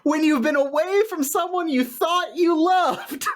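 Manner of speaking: put-on girlfriend's letter voice. Reads the girlfriend's letter aloud.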